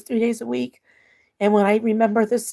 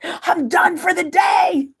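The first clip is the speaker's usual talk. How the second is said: slow, drawn-out words broken by laughter and a crying sound; laugh-cry it out, half shouting; real laughing wail, dragged slowly; 7 semitones higher, much slower than usual. Real, not acted. Laughing at her own burn.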